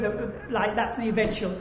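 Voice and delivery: ordinary delivery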